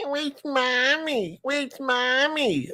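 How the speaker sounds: high, slow whimpering pug voice; whining dog voice. Retold or imitated. Owner voicing his pugs missing their mommy, high and slow. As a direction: ache for mommy like a needy dog.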